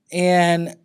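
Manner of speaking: normal talking voice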